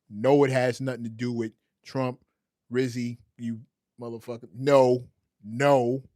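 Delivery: loud, emphatic denial; loud protest. Real, not acted. To chatter Rizzy about Trump, loud denial. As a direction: shout the suggestion down. Shouting down a chat suggestion.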